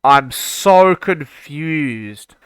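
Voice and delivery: drawn-out confusion. Real, not acted. Rocket flipping again.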